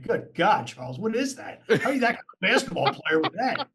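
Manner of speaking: high, laughing through the words; caddy laughing at Barkley's ugly swing; laughing disbelief. Retold or imitated. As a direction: crack up while mocking a terrible swing. Caddy reacting to awful golf swing.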